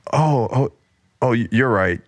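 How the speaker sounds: a mild hint of a backing-down child; twelve-year-old. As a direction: slip back into a cowed twelve-year-old. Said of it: "reverting to a twelve-year-old".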